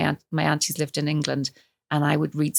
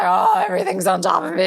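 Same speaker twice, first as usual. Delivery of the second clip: mock overwhelmed wail; 12 semitones higher, 4 dB louder than usual. Imitating daughter's idea of menopause.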